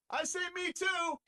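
squeaky voice from off to the side; mock Magic cameo. Magic butting in from the side, high and slow.

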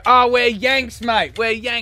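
mock Aussie-American reply. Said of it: "all their American friends go".

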